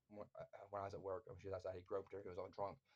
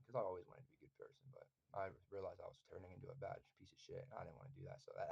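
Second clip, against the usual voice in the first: ordinary delivery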